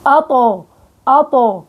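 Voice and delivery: أطو with a bouncing echo on the stopped ط; tajweed drill of an echoing ط in أطو; qalqala demo. Shown as a demonstration. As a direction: stop hard on the ط in أطو and let it bounce. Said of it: "وقت بجيب القلقلة".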